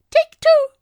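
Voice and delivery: tiny squeaky robot, loud and high; high-pitched robot voice. A narrator's reading. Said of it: "said something high-pitched".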